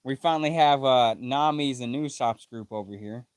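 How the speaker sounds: usual speaking voice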